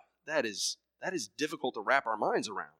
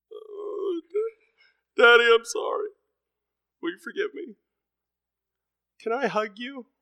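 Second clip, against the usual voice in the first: put-on high pleading little-kid voice; pitiful crying child; 12 semitones higher, 6 dB louder than usual. Disciplined child pleading with her dad, high and pitiful. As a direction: beg forgiveness like a sorry small child. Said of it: "They're crying. It's pitiful".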